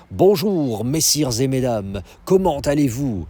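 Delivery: put-on standard French accent. Themed restaurant waiter greeting the guests, in standard French accent. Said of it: "they speak with a standard French accent".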